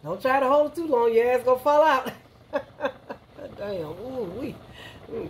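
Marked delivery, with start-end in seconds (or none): none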